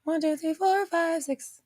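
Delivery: high, sing-song puppet counting; Sesame Street counting. Shown as a demonstration. Streamer showing chat how she counted in Sesame Street voice. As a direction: count like a children's TV muppet. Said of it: "I had to say it like, um, Sesame Street".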